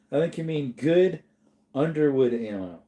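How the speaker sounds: ordinary delivery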